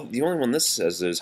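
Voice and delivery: ordinary delivery, nothing marked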